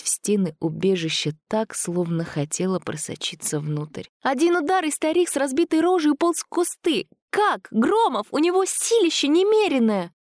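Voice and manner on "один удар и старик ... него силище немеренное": very high, agitated outcry; frantic accusation. A narrator's reading. Girl confronting Gromov over his strength, frantic accusation. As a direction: pour it out in panicked disbelief.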